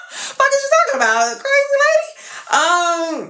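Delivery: high, laughing what-are-you-saying; laughing disbelief. Real, not acted. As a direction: laugh through the words in disbelief. Mocking cousin's crazy plan.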